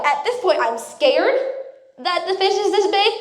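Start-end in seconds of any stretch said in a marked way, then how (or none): none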